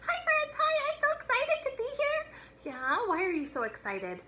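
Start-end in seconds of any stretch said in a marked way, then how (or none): 0.1-2.3 very high squeaky puppet voice. Acted. Librarian voicing puppet Pongo for kids, high and squeaky. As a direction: chirp it like an excited puppet.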